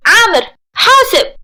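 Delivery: loud, high warning cry; forceful scream. A narrator's reading. Noursin warning Amer to watch out, screaming. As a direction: yell a sharp warning with force. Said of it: "فصرخت نورسين بقوة".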